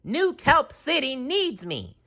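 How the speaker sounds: high matter-of-fact declaration; leaving with dignity; SpongeBob announcing. A narrator's reading.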